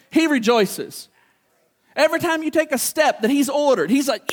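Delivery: ordinary talk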